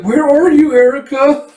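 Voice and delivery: very high, loud searching call; hammed-up high, plaintive shout; lost child calling. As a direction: call out high like a little kid looking for someone.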